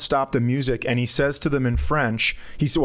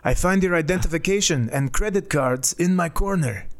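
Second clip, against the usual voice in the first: French man voice; 4 semitones higher than usual. Retold or imitated. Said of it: "And he goes".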